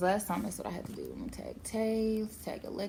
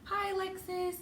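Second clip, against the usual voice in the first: high greeting; 12 semitones higher than usual. Real, not acted.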